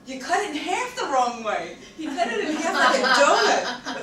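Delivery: high, loud exclamation; exasperated outburst. Retold or imitated. Telling friend he cut the bagel wrong, exasperated. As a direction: blurt it out loudly, voice leaping up. Retold exasperated realisation, shouted.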